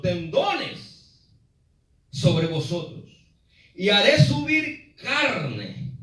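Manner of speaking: slow, drawn-out proclamation; solemn scripture reading. Acted. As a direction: intone each phrase with long pauses. Reads God's words slowly.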